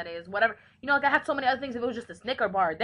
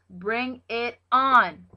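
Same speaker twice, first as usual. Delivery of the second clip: slow, emphatic and louder challenge; 3 semitones higher, 10 dB louder, much slower than usual. Real, not acted. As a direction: drag it out as a fired-up dare. Repeats the phrase louder.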